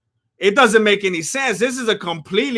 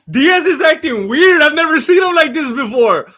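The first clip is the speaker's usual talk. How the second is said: laughing excitement; 6 semitones higher, 6 dB louder than usual. Real, not acted. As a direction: crack up while calling it. Laughing at Diaz's antics.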